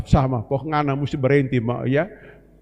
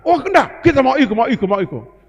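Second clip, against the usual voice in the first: insistent pleading; 9 semitones higher, 5 dB louder than usual. Acted. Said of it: "Elisa ngotot ikut Elia".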